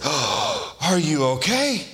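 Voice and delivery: gasp, then high fussing question; mock alarmed concern. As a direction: act the over-concerned adult checking on a child. Adult fussing over fallen child.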